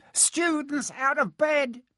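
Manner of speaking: very high, slow calling-out; Peeves, bellowing. A narrator's reading. Peeves alerting Filch to pupils, high bellow. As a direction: raise the alarm in a long, high cry. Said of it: "Peeves bellowed".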